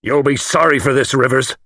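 high, intense warning; trembling with feeling. A narrator's reading. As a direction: warn him with intense feeling. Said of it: "His voice trembled with intense feeling".

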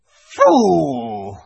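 high, loud contemptuous witch; savage near-snarl. A narrator's reading. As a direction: spit it out with savage scorn. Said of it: "savage smile that was almost a snarl".

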